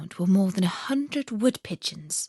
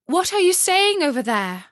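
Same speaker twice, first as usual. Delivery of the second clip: high, louder call across the room; calling across to the pigeons in the dark; 10 semitones higher, 4 dB louder than usual. Acted. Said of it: "cried Gerda".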